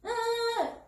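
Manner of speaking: put-on very high, held toddler whine; imitated wordless whine. Consultant mimicking child who thinks this is speech, high whine. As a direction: whine high without opening into words. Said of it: "이렇게 했잖아?".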